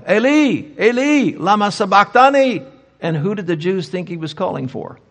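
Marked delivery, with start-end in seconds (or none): 0.1-2.6 loud, high quoted cry from the cross; crying out in Aramaic. Retold or imitated. Preacher quotes Jesus' cry on the cross, crying out. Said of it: "he cried out in Aramaic".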